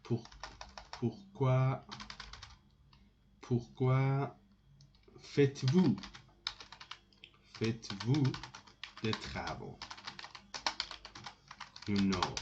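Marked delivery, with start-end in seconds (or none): 0.0-9.8 halting, with long gaps between word groups; dictating while writing. Shown as a demonstration. Teacher writing the inversion question, spaced-out dictation. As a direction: say it piece by piece as you write.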